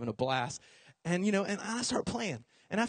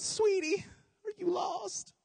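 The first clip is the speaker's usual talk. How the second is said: put-on high, soft old-lady coo; sweet old lady; 12 semitones higher, 4 dB quieter, slower than usual. Old lady finding lost boy, high sweet coo. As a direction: coo kindly to a lost child. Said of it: "she goes".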